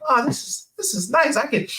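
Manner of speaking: high, relaxed sigh of comfort; hammed-up comfy under a heavy guy; mock contentment. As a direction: savour it like a warm bath. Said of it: "I was just like".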